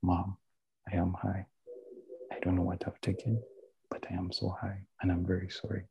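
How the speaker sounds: hushed, owning up to his mother; quiet confession. Retold or imitated. Confessing to mom he is high.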